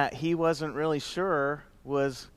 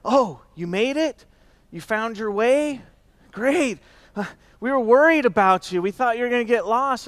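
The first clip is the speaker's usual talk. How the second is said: mock worried greeting; 8 semitones higher, 6 dB louder than usual. Retelling people's worried greetings.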